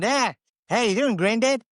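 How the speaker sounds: high, South African-sounding grandkid voice; mock South African accent. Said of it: "speaking in a South African accent".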